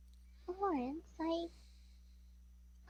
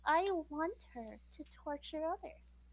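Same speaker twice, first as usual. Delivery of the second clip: seductive; 2 semitones lower, 8 dB quieter than usual. Acted. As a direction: purr it seductively. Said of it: "in a very seductive voice".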